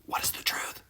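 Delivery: breathy whispered question; hushed, eerie whisper. Acted. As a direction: whisper it like a secret. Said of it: "whispered question".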